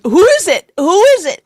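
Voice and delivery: hammed-up pestering husband; mock pestering husband. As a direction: nag for an answer during the movie. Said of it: "and then".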